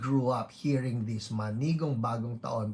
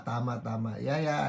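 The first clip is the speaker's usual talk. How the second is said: ordinary talk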